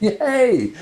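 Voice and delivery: high bright cheer; happy little whoop; excited yay. Real, not acted. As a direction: cheer it out loud. Excited cheer before reading.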